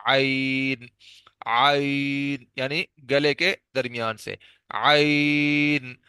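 عین squeezed from the middle of the throat; throat letter demo. Shown as a demonstration. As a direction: hold عین long and throaty, the last one very slow. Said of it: "عین کی ادائیگی بھی ہا والی جگہ سے".

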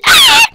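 sudden high-pitched scream. Real, not acted. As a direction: shriek as if startled by the game. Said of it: "Sorry for screaming".

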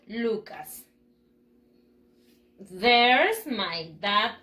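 slow, drawn-out phrases with long pauses; repeat-after-me pronunciation model. Shown as a demonstration. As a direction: model each phrase deliberately for learners to echo. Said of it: "Repeat after me". (lectura pausada para repetir)

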